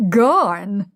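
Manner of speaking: put-on Higgins echoes the girl's cockney "garn"; a light copy of the cockney "garn"; mimicking cockney. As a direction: parrot her cockney "garn" back, drawn out. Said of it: "as the girl very properly says".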